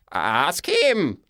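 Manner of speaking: plain reading voice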